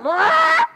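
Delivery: loud, high-pitched parrot screech; sudden piercing squawk, imitating a parrot. Parrot interrupting a man's sad story, loud squawk. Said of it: "the bird is like".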